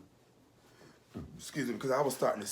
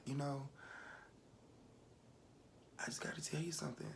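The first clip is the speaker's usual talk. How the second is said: very soft, higher, whispery confession; tearful hush; 5 semitones higher, 14 dB quieter, faster than usual. Retold or imitated. Crying grandchild confiding in grandmother, hushed. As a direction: confide it almost without voice. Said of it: "And I just was crying".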